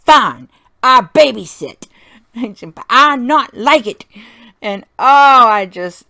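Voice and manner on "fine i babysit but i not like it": put-on loud, huffy little-girl voice with an eye roll; grudging kid, loud and put-upon; sulky toddler. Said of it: "I couldn't believe her".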